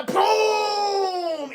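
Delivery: huge high, loud boom; explosion noise. Acted. Streamer voicing the Death Star blowing up, explosive shout. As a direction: shout a long booming blast.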